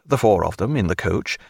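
ordinary delivery

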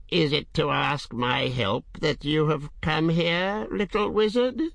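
high, sly questioning dragon. A narrator's reading. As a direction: ancient dragon, teasing.

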